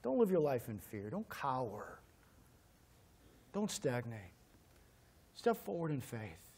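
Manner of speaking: the Holy Spirit quietly saying go ahead; gentle encouragement. Retold or imitated. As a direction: gently urge them forward. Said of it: "We hear the Holy Spirit say".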